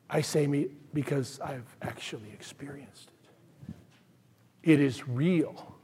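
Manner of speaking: hushed, heartfelt testimony to a doubter; quiet sincere. Retold or imitated. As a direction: pull back soft and mean every word. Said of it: "there's been times I have to say".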